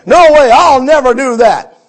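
loud, defiant refusal; mock raging refusal. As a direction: slam the door on God's command. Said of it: "he stomped off in a rage".

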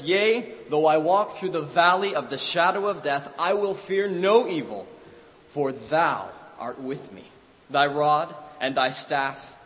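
loud, slow, ringing scripture proclaimed; fervent psalm reading. Acted. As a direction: cry the psalm out over his father, slowly. Boy reading psalm over father.

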